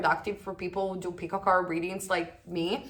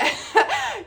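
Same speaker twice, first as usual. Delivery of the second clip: a sudden high laugh; high burst of laughter; 4 dB louder than usual. Real, not acted. Reader laughing at her own boast, high laugh.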